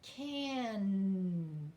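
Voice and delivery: Can, the final N held long; held N at the end. Shown as a demonstration. Teacher to class, holding the final N. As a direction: stretch the N of Can. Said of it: "hold the last sound with me".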